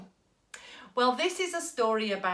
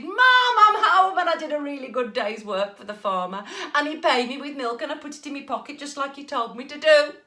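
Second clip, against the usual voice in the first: much higher loud call; shouting; 4 semitones higher, 7 dB louder than usual. Acted. Jack shouting proudly to mum. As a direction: shout it cheerfully through the door. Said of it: "he shouted".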